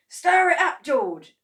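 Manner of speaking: high, loud urging shout. A narrator's reading. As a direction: shout it across the pot. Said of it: "shouted Mister Cranky".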